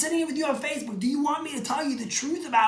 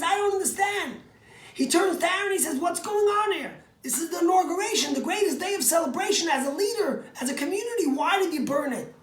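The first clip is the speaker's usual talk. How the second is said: high, loud, exasperated challenge; upset Moses; 7 semitones higher, 4 dB louder than usual. Acted. Moses confronts grieving brother Aaron, high indignant outcry. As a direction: Moses demands why the offering burned, upset and loud.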